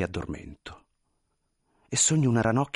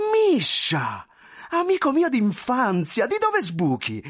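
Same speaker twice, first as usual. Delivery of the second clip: high, effusive surprise; excited, high-pitched cries of recognition; amazed delight; 12 semitones higher, 4 dB louder, slower than usual. Acted. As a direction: hail a childhood friend in astonishment. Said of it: "stupì lo smilzo".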